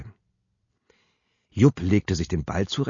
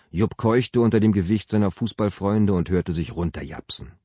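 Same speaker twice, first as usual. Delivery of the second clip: ordinary delivery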